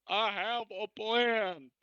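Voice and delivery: high, slow dramatic declaration; heroic announcement. Acted. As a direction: declare it slowly like a cartoon hero. Hero announces plan in character.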